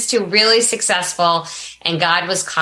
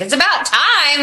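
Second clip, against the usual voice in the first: husband's quip; 10 semitones higher than usual. Retold or imitated. Husband's retold reply.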